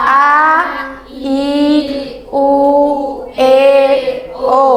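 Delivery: A, I, U, E, O as separate, held vowels; vowel drill, A, I, U, E, O with pauses between; clear articulation. Shown as a demonstration. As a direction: sound out A, I, U, E, O one by one, distinctly. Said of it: "Artikulasi, kejelasan yang sudah dicontohkan".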